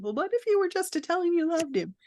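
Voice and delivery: lightly lampoon the husband's gentle advice; wife mimicking her husband's suggestion, high and fast. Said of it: "And I hear my husband".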